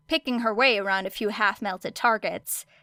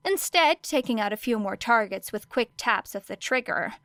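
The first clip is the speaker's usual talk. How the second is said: ordinary delivery, nothing marked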